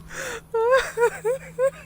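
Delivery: high rising giggles; laughing helplessly; giggle fit. Real, not acted. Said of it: "I can't stop laughing".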